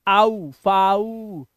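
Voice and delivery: आउ and फाउ drawn out very slowly; sounding out found. Shown as a demonstration. Teacher building फाउंड from its sounds, very slow. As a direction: stretch आउ then फाउ, syllable by syllable. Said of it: "ऐसे ही फाउंड".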